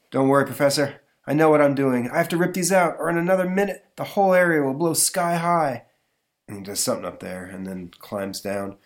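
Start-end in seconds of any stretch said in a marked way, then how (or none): 0.1-5.8 excited hero voice. Acted. Comic read, hero voiced.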